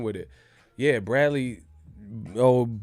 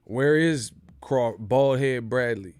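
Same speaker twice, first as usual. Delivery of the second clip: usual speaking voice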